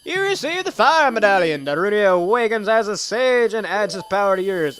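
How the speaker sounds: loud, high, grand proclamation; item announcement. Acted. Player reading the medallion message, hammed-up triumphant announcement. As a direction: belt it out like a prize announcement.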